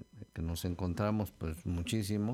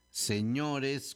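señores with a lifted, leaned-on ÑO; se-ÑO-res, accent overdone; exaggerated stress; 4 semitones higher than usual. Shown as a demonstration. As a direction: punch the O of señores. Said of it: "con acento en la O".

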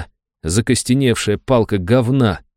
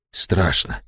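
frightened hush; 4 semitones lower, 4 dB quieter than usual. A narrator's reading. Fear while counting underwater.

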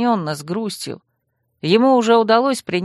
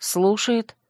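plain reading voice

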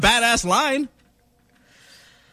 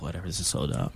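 dismissive mumble; 13 dB quieter, faster than usual. Retold or imitated. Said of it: "they'll half-ass it because it's like".

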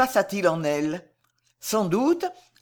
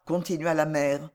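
plain reading voice

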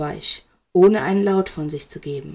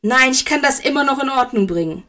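higher, urgent insistence; gasped protest; 5 semitones higher, 3 dB louder than usual. A narrator's reading. Severus insists he can still fix it, gasping. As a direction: protest it, pitch raised. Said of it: "keuchte Cyrus".

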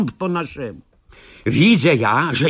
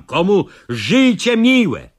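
loud, high call to flee; 5 semitones higher, 4 dB louder, slower than usual. Acted. Narrator calls villagers to flee, loud high call. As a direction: rouse the village to run. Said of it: "wołam".

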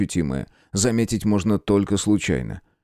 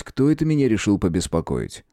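ordinary delivery

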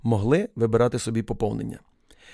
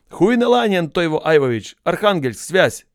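loud, higher barked name and posting; officer shouting; 5 semitones higher, 5 dB louder, slower than usual. A narrator's reading. Officer announcing a recruit's posting to the crowd, loud higher shout. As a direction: yell it across the parade ground.

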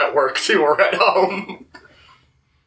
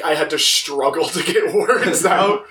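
ordinary talk, nothing marked

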